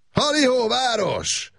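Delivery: high, ringing call across the valley; joyful call. A narrator's reading. Gábor calls out to the town below, joyful and high. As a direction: hail the town from the hillside, elated. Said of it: "kiáltotta Makos Gábor".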